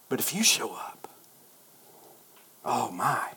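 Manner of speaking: hushed, near-whispered wonder; hushed awe. Retold or imitated. Preacher imagining God showing up, hushed awe. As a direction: drop low and soft, awestruck. Awed aside within his prayer.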